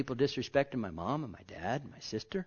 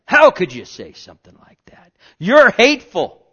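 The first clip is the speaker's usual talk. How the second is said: loud, indignant imitation; hammed-up offended unbeliever; mock offended critic; 7 semitones higher, 18 dB louder than usual. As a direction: shout the critic's outrage.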